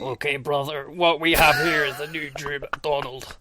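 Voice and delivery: wrestler's promo impression, put-on voice; mock wrestling promo voice; Drew MacDonald. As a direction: play the wrestler opening his promo. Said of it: "His promo is just like".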